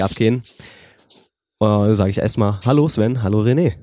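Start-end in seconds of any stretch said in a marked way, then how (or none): none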